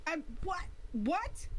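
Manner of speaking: high-pitched disbelieving question; startled. Acted. Player watching her grab the dangerous eyes, startled. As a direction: blurt it in high, rising surprise.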